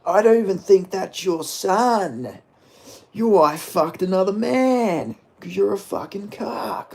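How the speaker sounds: a hint of a nasty Aussie fighter; mock trash talk. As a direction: cut down a rival at the press conference. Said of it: "J D M would be like".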